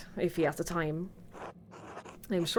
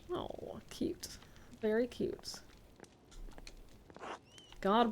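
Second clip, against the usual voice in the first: whisper; 11 dB quieter, slower than usual. Real, not acted. Reacting to the dog.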